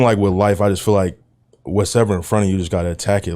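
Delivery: normal talking voice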